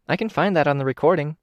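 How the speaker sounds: a hint of a bubbly kid showing off; young girl's voice. Kenzie's voice, narrator reading.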